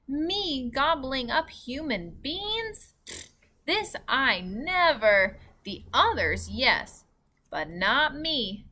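slow, emphatic storybook protest; giant's shouted protest. A narrator's reading. Giant denying that he eats human beings, emphatic protest. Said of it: "shouted the giant".

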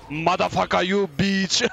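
excited trash talk; gloating outburst. Real, not acted. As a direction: crow the swear words in triumph.

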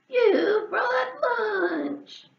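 very slow, high, loud shout of joy; delighted cry. Acted. Goat thrilled at the food, slow high joyful shout. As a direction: stretch it out in greedy glee. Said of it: "cried Goat".

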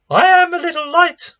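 high, loud mock voice, timid; a tiny high squeak of a claim; squeaky little voice. Preacher's joke version of Jesus, high squeak. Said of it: "No, he doesn't".

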